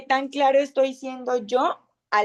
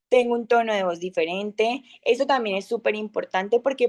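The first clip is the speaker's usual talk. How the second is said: ordinary talk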